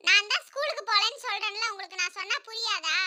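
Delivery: put-on soft, thin little-boy voice; defiant son Jakku. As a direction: talk back to mother in a small voice. Son talking back to mother. (எதிர்த்துப் பேசும் சிறுவனின் மெல்லிய குரல்)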